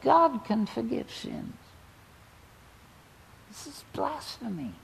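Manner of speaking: muttering Pharisees. Retold or imitated. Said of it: "they're muttering to each other in this secret little enclave".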